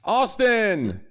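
high, loud ring-announcer call; hype announcer shout. Shown as a demonstration. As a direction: belt the name out like a hype man. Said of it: "but others know him as Austin".

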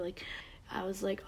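normal talking voice

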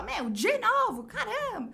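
loud, high, slow fed-up cry; exasperated outburst. Retold or imitated. Partner exasperated at a repeat. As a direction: blurt it out, fed up with the repetition. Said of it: "que você fala".

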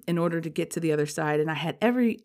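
ordinary delivery

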